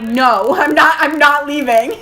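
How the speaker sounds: loud re-enacted refusal; dramatic mock yell of defiance; emotional retort. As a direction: loudly act out refusing a parent. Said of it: "I would never be that emotional".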